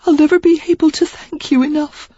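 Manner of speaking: put-on high, heartfelt young woman; high tearful gratitude. A narrator's reading. Sarah thanking Derek, tearful. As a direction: thank him earnestly.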